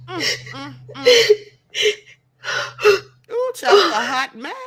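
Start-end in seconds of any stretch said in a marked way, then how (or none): 0.1-1.4 helpless laughter. Real, not acted. Host overcome with laughter. 3.3-3.9 laughing exclamation. Real, not acted. Host laughing at bald photo.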